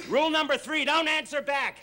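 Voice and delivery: scolding. Acted. Harvey scolds Sonny.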